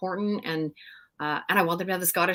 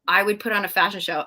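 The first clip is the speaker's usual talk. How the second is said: ordinary delivery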